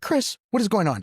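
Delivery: high, anxious demand; retold alarm. Patient calling to nurse Chris, high and alarmed. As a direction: call out to the nurse in mock panic.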